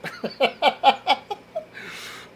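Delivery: high chuckling laugh. Real, not acted. Comic fan amused by his own Russian accent, laughing. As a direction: burst into a high run of laughter.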